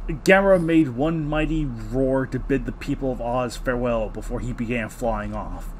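ordinary talk, nothing marked

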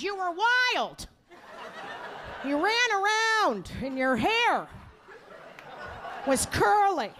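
high, slow, drawn-out mom voice; mother. Retold or imitated. Mother struggling to recall her child's hobbies, drawn out. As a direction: stretch each phrase out, grasping at memories. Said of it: "This is what she said".

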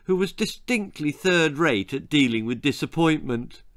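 ordinary delivery

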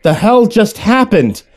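high, drawn-out disbelief; startled. Acted. Passenger jolted by the sudden stop, startled. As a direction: demand an answer, bewildered and slow.

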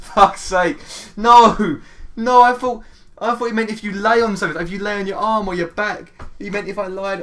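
high, loud, fast protest; dismayed protest. Real, not acted. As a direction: blurt it out in disbelief. Realizes he misread question.

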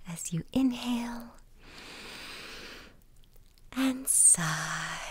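soft whisper, slow on the breathing; whispered meditation. Acted. Host guiding listeners through a breathing exercise, whispered meditation. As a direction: whisper them gently into a relaxing sigh.

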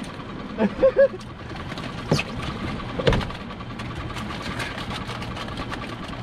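airy giggle of delight; breathy excited laugh. Real, not acted. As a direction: laugh under your breath, thrilled. Laughing after the catch.

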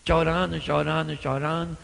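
low, softened repetition; quiet acted grief; subdued sorrow. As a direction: repeat it low and heavy. Mimics Jesus weeping.

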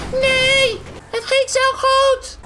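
high-pitched cry of dismay; very high, drawn-out protest; dismayed wail. Pretend. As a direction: wail it as it all goes wrong. Landing fails in the game.

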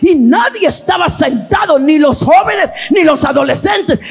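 rising, sing-song preacher's cadence; impassioned preaching. Real, not acted. Preacher recalls young people kneeling before the service, impassioned preaching.

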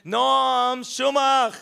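ordinary talk

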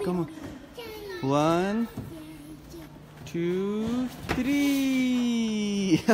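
ordinary delivery

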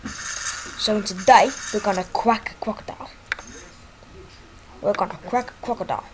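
light mock Australian accent, brisk; Aussie croc-hunter voice. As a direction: play the wildlife host launching the show. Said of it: "this is what he really sounds like".

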